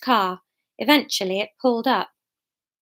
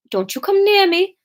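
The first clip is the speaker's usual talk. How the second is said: high-pitched warning in uncertain Welsh accent; retry the Welsh accent, pitched higher; attempted Welsh accent; 8 semitones higher, 3 dB louder than usual. Shown as a demonstration. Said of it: "can't get back into Welsh".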